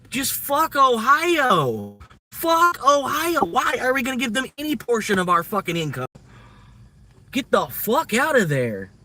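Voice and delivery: high, loud, drawn-out mock yell; retold exasperated yell. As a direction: yell it like you're fed up with Ohio. Retold shouting at his partner.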